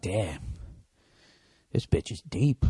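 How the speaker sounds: low, soft mock impressed guy; a hushed, dopey impressed guy; mock dude voice.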